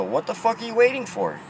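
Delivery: sharp high furious shout at the worker; angry yell. Retold or imitated. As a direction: yell it, fed up and looking for a fight. Confronting drive-through worker, retold.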